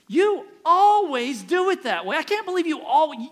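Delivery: accusing always. Retold or imitated. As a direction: mimic a spouse's whiny blame. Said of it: "The word always".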